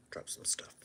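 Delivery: breathy whispered aside. Real, not acted. Repeating to himself, a whispered aside. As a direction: drop it to a whisper. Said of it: "whispered aside".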